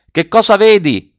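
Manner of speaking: high, loud called question; calling up. A narrator's reading. Officer to the boy in the tree, calling out. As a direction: shout the question up into the treetop.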